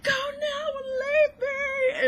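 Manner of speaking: high, loud, drawn-out mock scream; imitated scream. Fan imitating the Phantom's outburst, screaming. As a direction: scream it slowly like an anguished film performance. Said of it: "he's, like, screaming".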